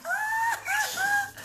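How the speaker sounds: mocking laughter. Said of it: "others are laughing".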